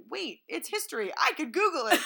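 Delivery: high, loud eureka; loud mock discovery; sudden realization. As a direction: shout the idea like a breakthrough. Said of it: "Then I was like".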